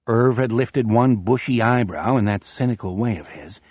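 ordinary delivery

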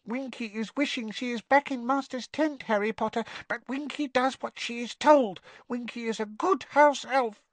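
very high squeaking voice; a house elf meeting someone famous; tiny elf squeak. Acted. Winky introducing herself to Harry, awed squeak. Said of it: "a teeny quivering squeak of a voice".

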